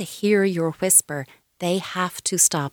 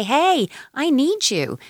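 high, slow, drawn-out call on "Hey"; a demonstrated shouty call, pitched up and stretched; big shouty voice; 8 semitones higher, slower than usual. Shown as a demonstration. Giving an upset toddler words for screaming, big shouty voice. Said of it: "using your big shouty voice".

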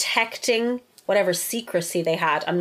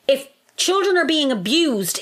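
usual speaking voice

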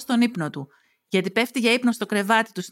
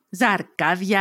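ordinary delivery

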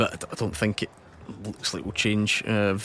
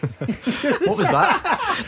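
high slow laughing disbelief; much slower than usual. Real, not acted. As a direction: laugh through the question. Laughing at the seagull impression.

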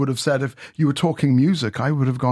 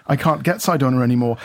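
ordinary talk

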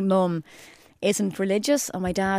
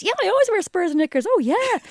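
much higher, louder eager gushing; eager crush-chasing girl; 11 semitones higher, 4 dB louder than usual. Retold or imitated. Younger self faking interest for a boy, high eager gush. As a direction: squeal it out to win him over. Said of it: "morph into the kind of person".

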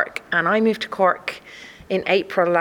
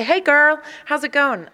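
cheery Cork greeting; 8 semitones higher than usual. Retold or imitated. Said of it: "So people would say".

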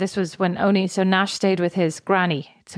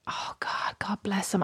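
whispered exasperation; 9 dB quieter, slower than usual. Retold or imitated. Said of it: "I was literally like".

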